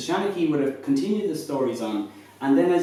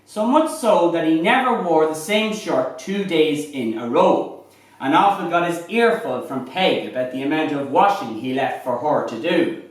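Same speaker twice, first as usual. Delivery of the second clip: higher, lively Irish fireside storytelling; lilting irish storyteller; 6 semitones higher, 4 dB louder than usual. Acted. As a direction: spin a comic tale by the fire, building to the punchline. Shanachie performs a comic goat tale.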